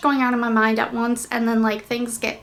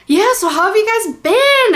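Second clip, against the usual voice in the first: excited greeting; 8 semitones higher, 8 dB louder than usual. Real, not acted. Bright greeting to viewers.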